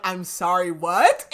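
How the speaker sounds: mock-startled, landing loud on "what"; retold disbelief. Host retelling his reaction to "mean daddy", mock disbelief.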